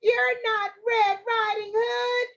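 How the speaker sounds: high, slow granny protest; high-pitched, drawn-out disbelief; alarmed grandmother. Acted. Grandmother unmasks the wolf, high alarmed voice. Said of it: "she said".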